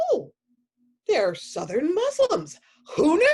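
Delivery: high, loud, slow amazed gasp breaking into a laugh; stunned "who knew" with laughter; caught-off-guard surprise. Retold or imitated. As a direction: replay the moment the accent threw you, laughing. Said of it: "I went".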